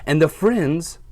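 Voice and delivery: storybook read-aloud for little listeners; reading to children. A narrator's reading. Teacher reads to her class, storytime voice.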